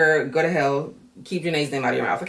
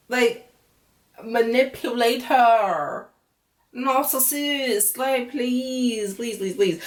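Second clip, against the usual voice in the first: slow, higher, drawn-out labels; knowing drawl; 5 semitones higher, much slower than usual. Real, not acted. Host branding Rob a narcissist, slow knowing drawl. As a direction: spell out what he is, dragging each word.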